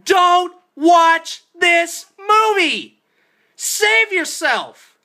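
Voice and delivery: slow, loud, high, word by word; screamed warning. Real, not acted. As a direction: yell each word as a desperate warning. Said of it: "screaming at you".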